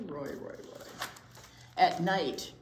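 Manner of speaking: ordinary talk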